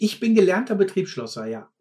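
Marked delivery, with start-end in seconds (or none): none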